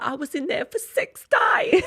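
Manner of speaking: high, slow British reality-star drawl; hammed-up Essex celebrity voice; Gemma Collins impression. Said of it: "That was a really good impression".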